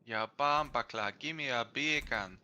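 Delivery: playful Jamaican patois; light Jamaican accent. Pretend. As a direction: ask for the beer can Jamaican-style.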